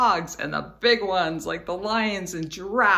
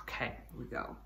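whisper; 9 semitones lower, 16 dB quieter, faster than usual. Real, not acted. Starting the song softly.

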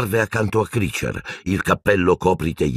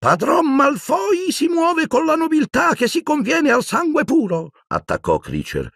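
put-on reverent old elf. A narrator's reading. Kreacher praising Malfoy.

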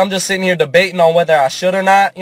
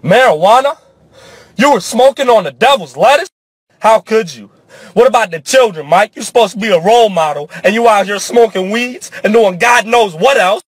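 higher, louder preachy outrage; hammed-up disapproving lecture; mock scolding critic; 5 semitones higher, 4 dB louder than usual. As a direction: play a scandalized fan wagging a finger. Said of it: "I know what you're thinking".

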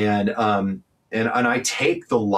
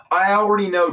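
defiant; 11 semitones higher, 4 dB louder than usual. Real, not acted. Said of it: "retort to the haters".

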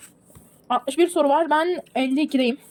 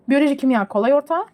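ordinary delivery

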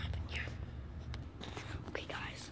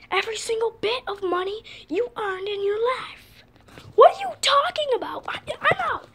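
tense whisper; 2 semitones higher, 3 dB louder than usual. Acted. Whispered tense exchange in skit.

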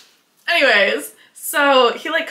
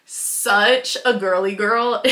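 normal talking voice

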